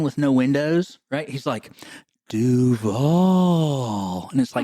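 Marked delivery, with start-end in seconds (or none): 2.3-4.3 creepy guy voice. Retold or imitated. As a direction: say it like a creep in a van. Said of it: "like, the creepy guy that drives around in the van".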